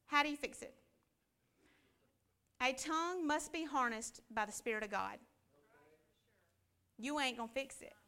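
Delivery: ordinary delivery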